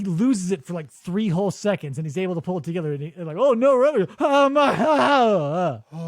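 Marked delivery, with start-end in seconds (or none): 3.4-6.1 mock electrocuted gasps. Acting being electrocuted.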